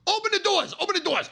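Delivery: high-pitched frantic cry; urgent yell. Retold or imitated. Man yells to friends to open the car doors, urgent high yell.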